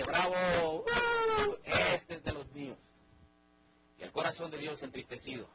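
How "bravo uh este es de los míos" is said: gloating devil. Acted. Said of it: "el diablo dice".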